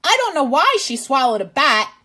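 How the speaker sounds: ordinary delivery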